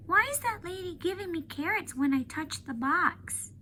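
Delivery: high, innocent little-elephant voice, puzzled; young elephant Ruby. Acted. Ruby asking Ivan about the carrots, high and puzzled. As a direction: play Ruby as a small, curious child. Ruby's voice in the read-aloud.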